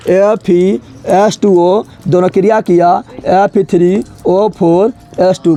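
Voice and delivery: very slow, each letter drawn out; slow dictation. Shown as a demonstration. Teacher dictating the formula to the class, very slow. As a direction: dictate it slowly so students can copy. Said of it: "फिर लिख दो एक बार".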